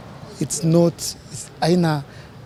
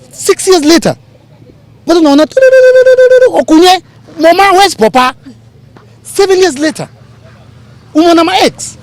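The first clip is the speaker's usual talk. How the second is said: mock Nollywood drama; 12 semitones higher, 6 dB louder than usual. Mocking Nigerian movies on TV.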